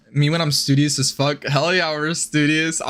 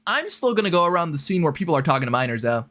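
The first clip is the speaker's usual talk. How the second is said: usual speaking voice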